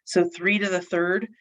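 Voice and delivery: normal talking voice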